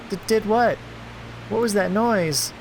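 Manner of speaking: put-on high-pitched startled girl; frightened girl. Girl to intruder in her room, startled and high.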